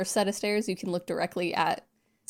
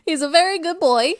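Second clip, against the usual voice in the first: high, louder mock praise with laughter; hammed-up doting quote; 8 semitones higher, 6 dB louder than usual. Friend repeats Missus Mack praising the boy, hammed up. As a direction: play up the fond reassurance, amused.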